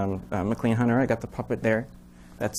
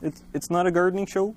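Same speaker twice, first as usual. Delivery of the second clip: goofy muffled sock puppet; 6 semitones higher than usual. Acted. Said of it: "puppet voice".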